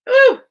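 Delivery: sudden high "woo" to reset; short, piercing whoop; loud high whoop. Real, not acted. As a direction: whoop away the tears. Shaking off the tears.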